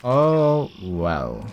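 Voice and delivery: long, stretched "Oh well" falling at the end; drawn out. Real, not acted. Player giving up on the locked house, drawn-out "Oh well". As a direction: stretch both "Oh" and "well", letting them sink.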